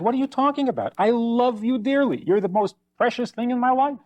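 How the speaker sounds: a husband insisting he loves her; hurt, high-pitched disbelief; protesting husband. Retold or imitated. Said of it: "He says".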